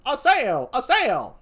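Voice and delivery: high-pitched stage call; offstage cry. Acted. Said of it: "Within, someone says".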